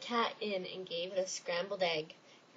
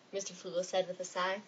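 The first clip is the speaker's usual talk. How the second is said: ordinary delivery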